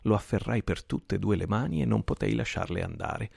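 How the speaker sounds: usual speaking voice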